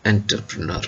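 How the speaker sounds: entrepreneur said as "enter-pre-nur"; accepted Indian pronunciation. Shown as a demonstration. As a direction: start entrepreneur with a plain "enter", hard t. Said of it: "if you can pronounce it as".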